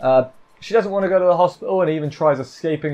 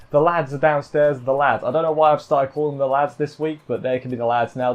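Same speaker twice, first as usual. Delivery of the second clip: usual speaking voice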